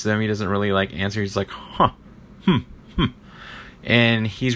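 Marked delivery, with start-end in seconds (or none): 1.5-3.1 aloof huh and hmm sounds; imitating a cool rich guy's noncommittal hums; dismissive grunts. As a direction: brush the person off with grunts. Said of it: "he makes all these sounds".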